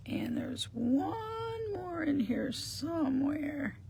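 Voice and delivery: high, slow, drawn-out searching tone; searching singsong. Real, not acted. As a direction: stretch it out in a high singsong while hunting. Hunting for a missing piece.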